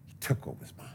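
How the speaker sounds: whispered grudge, no voice at all; bitter whispered vow; whispered threat. Acted. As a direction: breathe it like a secret vow of revenge.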